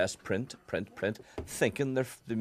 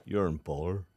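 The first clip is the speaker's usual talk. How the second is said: lightly voiced engineer, lower than usual; Ken; 5 semitones lower than usual. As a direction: slightly imitate a colleague giving a warning. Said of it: "It's a pretty good impersonation".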